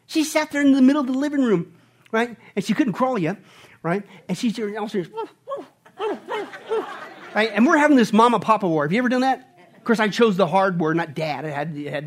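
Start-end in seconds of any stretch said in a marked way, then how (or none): 5.1-6.9 tiny, high, soft woofs; baby barking. Retold or imitated. Father imitating his baby daughter's first words, small high barks. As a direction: imitate a little one barking like a dog. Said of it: "first words was a bark".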